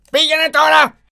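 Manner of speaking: Πήγαινε τώρα shouted high and loud, τώρα stressed; roaring command. Acted. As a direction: bellow Πήγαινε τώρα like a monster. Beast sends merchant away.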